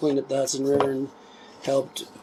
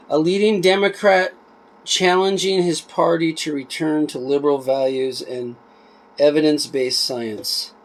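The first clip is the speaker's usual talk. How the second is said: reading a blurb aloud, a bit livelier than chat; book read aloud; 3 semitones higher, 4 dB louder, slower than usual. Acted. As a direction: read the back cover out to viewers. Reads back cover aloud.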